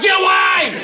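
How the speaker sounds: high-pitched recoiling yell; mock angry snap. Arguing couple in bed, feet touch, high yell. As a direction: repel an unwanted touch, hammed up.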